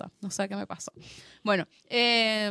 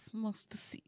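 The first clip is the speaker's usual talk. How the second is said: breathy little whisper; whispered aside; 13 dB quieter, slower than usual. Real, not acted. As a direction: drop to a whisper for the tag line. Whispered aside after recommendation. (susurro breve)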